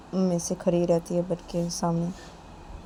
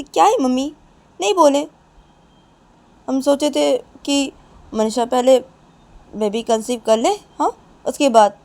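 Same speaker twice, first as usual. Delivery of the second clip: raised voice, pushed out loudly; loud defensive retort; 4 semitones higher, 11 dB louder than usual. Acted. Nisha explains the secret to mother, loudly defensive. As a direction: argue back and justify it. Daughter arguing back to upset mother.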